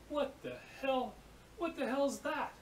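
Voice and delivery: high-pitched mock outrage; retold bafflement, voice jumping way up; livid disbelief. Steel worker baffled by his apprentice's signal, livid disbelief. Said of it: "He's just livid".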